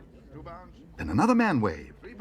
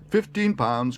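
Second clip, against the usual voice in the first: loud, raised auctioneer; auctioneer's call; 5 semitones higher, 5 dB louder, slower than usual. A narrator's reading. Auctioneer taking the young bear's bid, calling out. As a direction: announce the bid to the hall. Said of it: "called the auctioneer".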